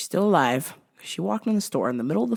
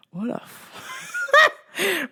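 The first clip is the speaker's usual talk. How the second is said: laughing; much slower than usual. Real, not acted. Breaks into laughter.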